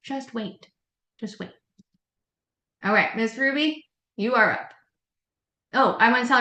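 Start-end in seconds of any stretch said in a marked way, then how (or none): 0.0-0.7 soft, near silent; softer Just wait; quiet advice. Shown as a demonstration. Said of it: "Don't waste your breath". 1.2-1.5 barely audible; fading Just wait; quieter still. Shown as a demonstration. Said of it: "Don't waste your breath".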